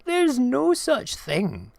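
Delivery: high, loud mocking mouse voice; squeaky-high gloating; gleeful mouse. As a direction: crow with glee, laughing at the fool who left. Mouse mocks the fooled owl.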